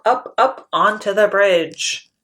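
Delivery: ordinary delivery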